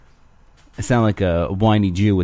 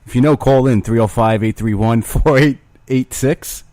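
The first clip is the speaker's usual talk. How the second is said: call-in announcer; 3 semitones higher, 5 dB louder than usual. Acted. Reads out the call-in number.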